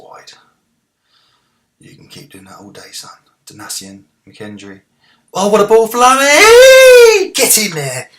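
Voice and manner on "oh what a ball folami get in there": very high, very loud, stretched cry of the name; ecstatic goal scream. Real, not acted. Gamer celebrating his striker's goal, ecstatic scream. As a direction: scream the scorer's name in joy.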